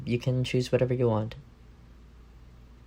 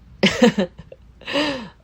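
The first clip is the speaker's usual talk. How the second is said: high teasing laugh; 14 semitones higher, 9 dB louder than usual. Acted. Partner refuses to share.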